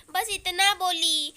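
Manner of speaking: usual speaking voice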